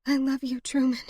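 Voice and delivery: high, tender, sorrowful voice; grieving farewell. A narrator's reading. Woman lying beside dead lover, high sorrowful voice. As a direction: say goodbye pitched high in grief.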